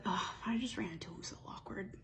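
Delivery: whispered, mortified aside; embarrassed whisper. Retold or imitated. Heroine confides her awkward run-in, whispering. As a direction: hush it like a secret you regret.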